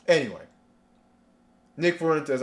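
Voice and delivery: ordinary talk, nothing marked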